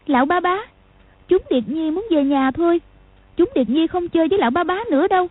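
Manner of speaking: put-on high pleading child, fast at the end; a small girl begging to go home; little girl voice. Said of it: "Trình Anh nói". (giọng bé gái)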